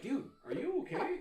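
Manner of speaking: mock-worried question through laughter; laughing concern. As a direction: ask while cracking up. Asking brother, laughing.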